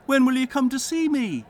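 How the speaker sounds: high, loud mock-cheerful voice; a falsetto of fake friendliness; gladsome greeting. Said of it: "to say with gladsome looks".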